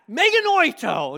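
Meganoito shouted high and loud; yelled out. Shown as a demonstration. Preacher shows the church what to yell, loud shout. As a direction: yell Meganoito back at a critic. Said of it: "Just end it all with that".

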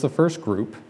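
ordinary talk, nothing marked

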